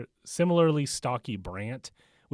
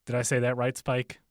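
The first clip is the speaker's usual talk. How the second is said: usual speaking voice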